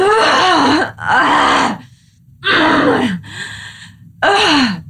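high, forceful frustrated groans letting the anger out; playful pent-up growls from the body; embodied anger groans. Shown as a demonstration. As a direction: let the anger move through you in wordless grunts. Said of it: "if you go".

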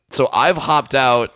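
normal talking voice

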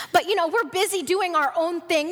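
normal talking voice

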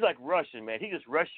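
ordinary talk

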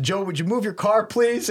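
exasperated, high-pitched retold plea; mock irritation, rising to a high please; fired up. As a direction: imitate a fed-up request, pitch climbing on please. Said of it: "I'm all fired up".

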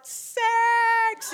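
high, loud, triumphant yell of one word; triumphant shout. Retold or imitated. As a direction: blurt it out like a husband who's just won. Husband's triumphant reaction retold.

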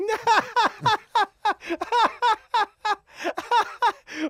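high, loud mock laughing fit; imitated son's laugh. Son laughing along with family at table, loud mock laugh. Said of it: "he loves to laugh".

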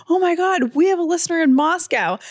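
high, amazed exclamation, acted out; hammed-up excited announcement; imitated excitement. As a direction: mimic someone thrilled by big news. Imitates husband's excited discovery.

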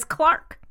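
"Clark" with an open "ar" for clerk; English pronunciation. Shown as a demonstration. Co-host confirming how England says clerk, "Clark" with an "ar". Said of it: "They pronounce it as".

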